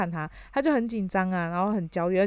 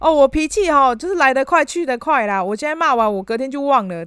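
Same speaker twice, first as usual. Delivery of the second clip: hot-tempered boss; 5 semitones higher, 6 dB louder than usual. Retold or imitated. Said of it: "就是说".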